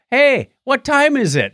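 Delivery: ordinary talk, nothing marked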